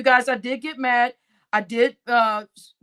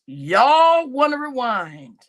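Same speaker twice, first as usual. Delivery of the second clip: slow drawn out; 4 dB louder, much slower than usual. Real, not acted. Slow emphatic host.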